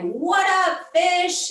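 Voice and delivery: loud, high Brooklyn greeting, "What up, fish?"; Brooklyn accent. Retold or imitated. Fox greeting the fish in a loud, high Brooklyn accent. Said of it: "this is literally how she tells the story".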